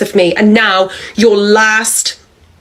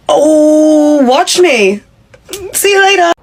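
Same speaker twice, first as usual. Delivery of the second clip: defiant glee; 7 semitones higher, slower than usual. Acted. Defiant walk-out from the job.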